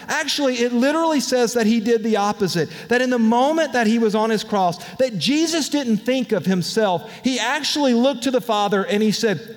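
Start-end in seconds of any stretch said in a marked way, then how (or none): none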